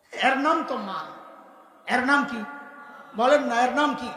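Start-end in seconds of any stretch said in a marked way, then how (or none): none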